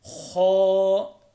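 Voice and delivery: खो with a heavy kh and rounded held vowel; thick, full. Shown as a demonstration. Teacher shows learners a heavy letter, thick खो held briefly. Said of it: "इसको मोटा करके पढ़ना है".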